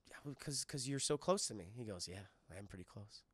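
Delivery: hushed, low romantic exchange; mock tender love-scene voice; acted intimate murmur. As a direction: drop to a soft, low near-whisper. Acts lovers drawing close.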